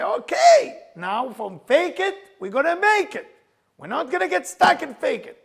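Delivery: loud, high coach's shouting; retold screaming. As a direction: act out yelling to drive the trainee onward. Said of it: "screaming at each other".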